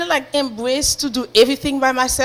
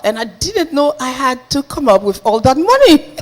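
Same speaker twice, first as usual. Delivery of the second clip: ordinary talk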